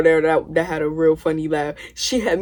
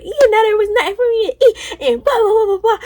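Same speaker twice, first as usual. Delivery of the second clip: high crying mock wail, much higher; mock screaming; 13 semitones higher, 3 dB louder than usual. Cousin complaining there was nothing to eat, high mock wail. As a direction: whine it through fake tears. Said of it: "She was like".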